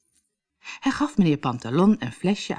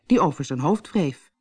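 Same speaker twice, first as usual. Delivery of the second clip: plain reading voice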